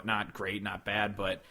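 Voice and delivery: ordinary talk, nothing marked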